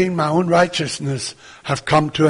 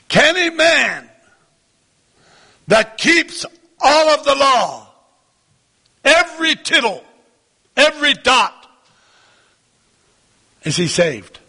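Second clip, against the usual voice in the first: emphatic preaching challenge; 6 semitones higher than usual. Real, not acted. Preacher puts challenge to congregation.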